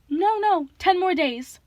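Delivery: high, anxious falsetto; panicked falsetto. A narrator's reading. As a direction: plead high and quick. Grover bargaining in falsetto.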